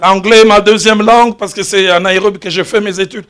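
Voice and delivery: usual speaking voice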